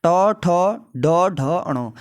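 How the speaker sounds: ट, ठ, ड, ढ, अणो with the tongue curled back; reciting retroflex letters. Shown as a demonstration. Teacher demonstrating the retroflex letters. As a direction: tap each letter off the roof. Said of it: "तो इसमें क्या हो रहा है".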